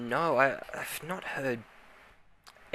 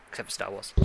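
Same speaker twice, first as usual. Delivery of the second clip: softer, tossed-off aside; quiet aside; 6 dB quieter than usual. Real, not acted. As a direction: slip it in under your breath. Slips in a teasing aside.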